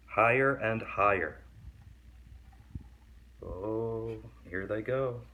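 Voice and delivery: put-on lively read-aloud for kids; story reading. A narrator's reading. Reading a picture book to kids, lively storytelling. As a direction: read the picture book to young listeners, animated.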